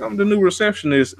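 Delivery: high, quick, eager-to-please; mock chirpy receptionist; naive chirpy voice. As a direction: announce it proudly like the naive new hire. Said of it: "And like".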